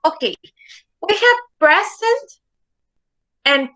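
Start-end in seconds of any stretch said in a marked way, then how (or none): 1.6-2.4 "present" as PRE-sent, pitched higher; teacher's demo of PREZ-ent; stress on first syllable. Shown as a demonstration. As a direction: say "present" high, weight on PRE. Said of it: "stress in the first syllable".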